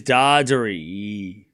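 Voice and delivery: Dardari with a long drawn-out final EE; stretched name. Shown as a demonstration. Announcing the player's name to chat, stretched ending. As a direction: say Dardari slowly and let the last vowel trail. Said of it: "His name is".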